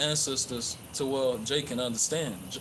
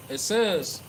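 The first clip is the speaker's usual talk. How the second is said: ordinary talk, nothing marked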